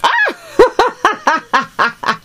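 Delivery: a high laughing cry of delight; delighted laughing shriek. Real, not acted. Opening [name]'s surprise gift, a high delighted laughing shriek.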